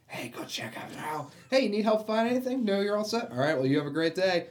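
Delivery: high-pitched mock customer-service chirp; imitated eager store greeter. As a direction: act out an over-helpful store clerk. Imitating staff greeting an attractive customer.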